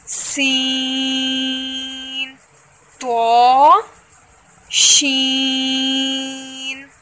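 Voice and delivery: letters read one by one, long ones drawn out slowly; slow drill of Arabic letter names; letter drill recitation. Shown as a demonstration. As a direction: recite each letter name as a Tajweed drill. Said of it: "एक दफ़ा फिर revise करते हैं".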